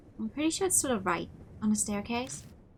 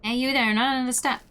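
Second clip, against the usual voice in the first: louder guard calling out; enthusiastic guard; 4 semitones higher, 5 dB louder than usual. A narrator's reading. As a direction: hail them with eager authority. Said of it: "Enthusiastic voice comes from a man".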